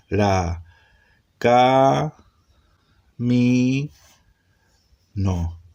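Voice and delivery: CA, MI, NO drawn out one at a time, with long pauses; syllable by syllable. Shown as a demonstration. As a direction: sound out ca, mi, no as separate syllables. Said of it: "¿cómo se lee? Consonante vocal". (ca, mi, no sílaba por sílaba, con pausas largas)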